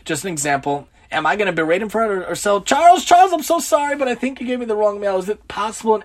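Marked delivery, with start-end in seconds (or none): 2.6-6.0 high, eager, apologetic plea; gushing sorry-to-bother-you tone; overly polite apology. Retold or imitated. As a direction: sweetly apologise to the mailman, pitched up. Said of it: "Much better example than".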